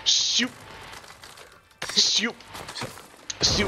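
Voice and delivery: high mock celebration call; Siu celebration cry. Streamer mocking a footballer's celebration, high and playful. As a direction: belt out a playful victory cry.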